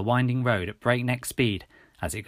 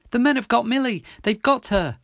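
high, loud, urgent and out of breath; panted; 12 semitones higher, 6 dB louder than usual. A narrator's reading. Runner telling policeman of kidnapping, urgent panting. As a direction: blurt it out as if winded from running. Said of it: "I panted, out of breath from running".

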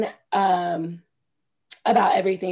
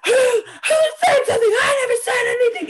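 high wailing yell, half crying; mock hysterical shout; 4 dB louder than usual. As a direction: screech it like you're freaking out. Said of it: "she's like".

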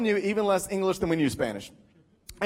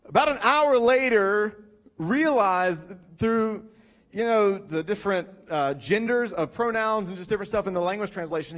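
ordinary talk

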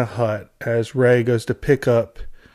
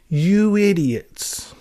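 slow, higher, despairing insult; slow exasperated insult; 5 semitones higher, much slower than usual. Real, not acted. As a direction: let the insult drag out in disbelief. Ends mock demo lesson.

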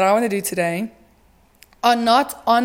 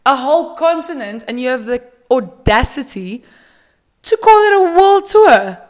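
much higher and louder on the audacity; ranting about skipping Africa, much higher and louder; indignant outburst; 3 semitones higher, 6 dB louder than usual. Real, not acted. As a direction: climax the rant in outrage. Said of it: "That's my little outbreak".

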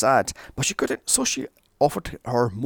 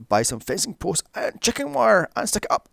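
usual speaking voice

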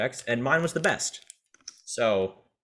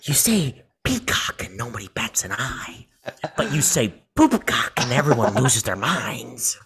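theatrical Joker, slowing on the second half; measured villain speech with dramatic pauses. Acted. Podcaster imagining the Joker's speech about peacocks, hammed-up Joker. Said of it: "he's like".

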